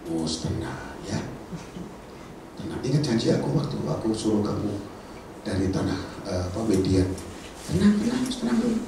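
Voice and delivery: soft, low soothing voice; calm him down gently; calm reassurance. Acted. God soothing Moses, soft and low. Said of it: "Tuhan bilang dari atas".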